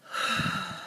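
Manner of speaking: soft unvoiced out-breath sigh; long sigh. Shown as a demonstration. Speaker demonstrating the calming breath, soft sigh. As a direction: let the breath go in a slow sigh. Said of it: "Okay, take a deep breath".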